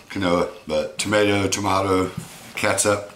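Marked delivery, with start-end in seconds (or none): 1.0-1.5 tomato said "tuh-MAY-toe"; tomato American. Shown as a demonstration. Joking about variant spellings, "tuh-MAY-toe". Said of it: "The way it's spelled". 1.5-2.1 tomato said "tuh-MAH-toe"; tomato British. Shown as a demonstration. Joking about variant spellings, "tuh-MAH-toe". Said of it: "The way it's spelled".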